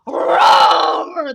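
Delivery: loud drawn-out roar; big storybook lion roar. A narrator's reading. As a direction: leap out roaring like a lion. Lion jumps out roaring.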